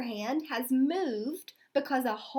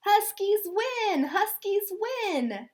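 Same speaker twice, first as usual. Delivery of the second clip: much higher, louder victory cheer; 8 semitones higher, 4 dB louder, slower than usual. Acted. The Huskies celebrating their victory, a high joyful cheer. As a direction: celebrate the win. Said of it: "cheered the team".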